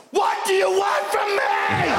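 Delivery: high, slow frustrated shout; yelling at his body. Retold or imitated. Teen boy shouting at his own body, high and slow. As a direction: cry out in exasperation. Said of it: "you're waking up going".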